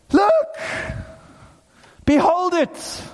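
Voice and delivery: high-pitched, emphatic cries; urgent proclamation. Acted. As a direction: proclaim it as astonishing, pausing between calls. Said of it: "You know what John is saying?".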